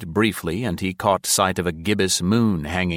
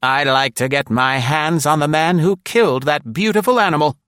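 put-on high, loud, outraged old woman; furious at the dog's killer; indignant elderly lady, high and loud; 9 semitones higher, 5 dB louder than usual. A narrator's reading. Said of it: "exclaimed indignantly under her breath".

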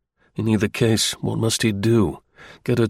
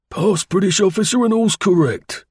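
higher, louder brisk military call; corporal's formula; 10 semitones higher, 3 dB louder than usual. A narrator's reading. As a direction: rattle off a routine challenge. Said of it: "the formula that the corporal used".

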